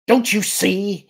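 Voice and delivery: high, loud, drawn-out shout; shouted plea. A narrator's reading. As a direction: cry it out slowly, demanding they understand. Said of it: "he shouted".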